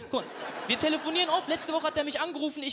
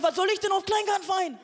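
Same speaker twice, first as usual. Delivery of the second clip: high-pitched incredulous question; incredulous protest; 6 semitones higher than usual. Retold or imitated. Acted protest at friend's invitation. (ungläubig, hoch protestierend)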